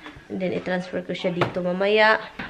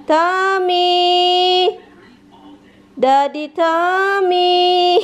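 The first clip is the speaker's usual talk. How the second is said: high, louder sing-song calling; excited calling; 7 semitones higher, 4 dB louder, much slower than usual. Real, not acted. Asking the dogs where Daddy Tommy is, excitedly. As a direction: call out teasingly to the dogs.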